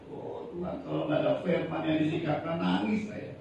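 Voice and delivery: soft, nearly whispered plea to God; hushed prayer. Retold or imitated. Pastor voicing his prayer to God, soft and hushed. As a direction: breathe it as a private prayer.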